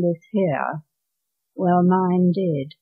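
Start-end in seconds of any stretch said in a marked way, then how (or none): none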